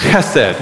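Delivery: Chesed with a throaty ch, then sed; Hebrew pronunciation. Shown as a demonstration. Pastor saying the word with the congregation, throaty ch.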